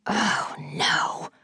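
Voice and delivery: breathless, voiceless oh no; dismayed whisper. A narrator's reading. Dog pees, dismayed.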